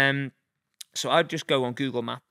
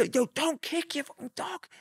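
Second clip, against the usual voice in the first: much higher mock yell at a stranger; retold yell. Yelling at a man to stop kicking his dog, high mock shout.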